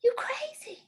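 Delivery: high, breathy disbelief; amazed high you crazy; husband's stunned quote. Retold or imitated. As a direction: squeak it out in amazement. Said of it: "And he was like".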